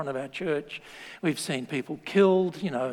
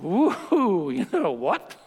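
a light laughing whoop; playful mock cheer; slower than usual. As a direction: cheer like an old man surprised by good news. Zechariah's joy retold playfully.

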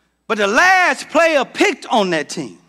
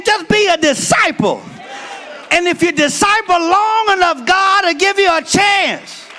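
preaching at full force; 7 semitones higher than usual. Acted. Pastor rousing the church about Ananias, full preaching shout. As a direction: whoop it up for the congregation.

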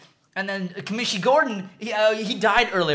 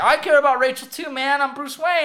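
higher, indignant retold protest; protesting Batman; 6 semitones higher than usual. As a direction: plead your case in a raised pitch. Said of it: "And he's like".

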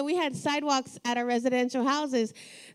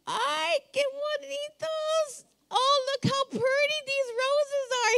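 put-on much higher, slow, cooing like a child over the roses; childlike dad voice; 11 semitones higher, slower than usual. Father gushing over his roses, in a high childlike coo. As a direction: gush like a little kid at his flowers. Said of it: "his voice would become like a child".

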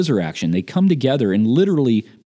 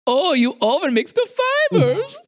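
much higher, amused German tech support voice; imitating German tech guy. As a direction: play the giggling German engineer. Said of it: "He's still German".

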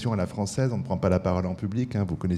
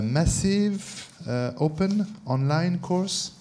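slow English words with a French accent; 9 semitones higher, much slower than usual. Real, not acted. Host spells out MOOC to the audience in French-accented English. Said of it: "avec mon accent".

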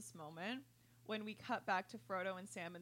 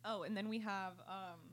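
ordinary delivery

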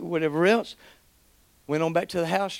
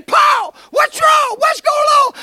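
high, loud alarmed shouting; frantic worry; 5 dB louder, slower than usual. Retold or imitated. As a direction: shout as a panicked friend. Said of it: "Jeremy starts flipping out".

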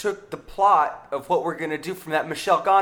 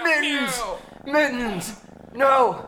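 anguished cry; 9 semitones higher, much slower than usual. Acted. Cop cries out as his cat takes the bullet. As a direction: cry out as your partner falls.

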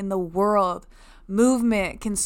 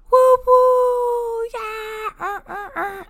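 much higher, loud whooping cheer; mock air horns and cheering; 14 semitones higher, 4 dB louder, much slower than usual. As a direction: play your own cheerleaders hyping yourself up. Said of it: "Those were air horns and my cheerleaders".

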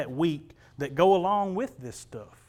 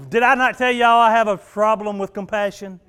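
ordinary talk, nothing marked

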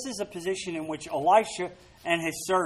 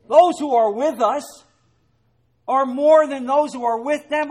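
bold proclamation; 8 semitones higher, 9 dB louder than usual. Acted. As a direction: proclaim it to the hills. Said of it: "preacher repeats at full force".

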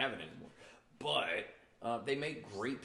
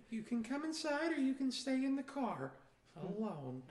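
high-pitched mock scolding wife; wife in dad's voice; 13 semitones higher than usual. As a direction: imitate a wife laying down an ultimatum, pitched way up. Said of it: "my wife doesn't talk like my dad either".

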